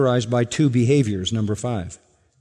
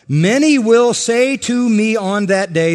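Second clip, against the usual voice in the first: raised, louder scripture line; forceful quote; 7 semitones higher, 6 dB louder than usual. Acted. Preacher lifting the verse up to the congregation, loud.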